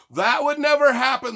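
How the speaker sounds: high, loud sarcastic shout; hammed-up shouted denial; mock outrage. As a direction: burst out in pretend indignation. Same mock protest about Portland.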